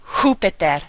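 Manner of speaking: Júpiter said "HOO-pee-ter", first syllable stressed; stress on accented vowel. Shown as a demonstration. Teacher giving an example, first-syllable stress on Júpiter. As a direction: hit the JÚ of Júpiter. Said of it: "the syllable that you will stress".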